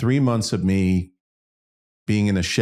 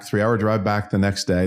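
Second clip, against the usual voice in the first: ordinary talk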